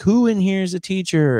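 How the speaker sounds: high voice calling to the crowd. Retold or imitated. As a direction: call out to the room.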